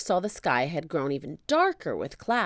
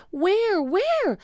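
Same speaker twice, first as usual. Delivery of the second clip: high, louder hurried asking; eager; 5 dB louder than usual. Acted. Jack asks Annie, high and eager. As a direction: scan the sea urgently.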